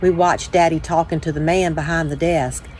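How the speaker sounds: ordinary delivery, nothing marked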